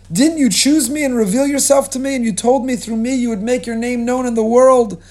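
high, loud plea to God from the drowning waters; desperate cry to heaven. Acted. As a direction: cry out to God, begging for rescue. Avram crying out to God.

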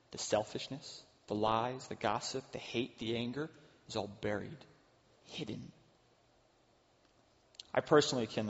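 ordinary talk

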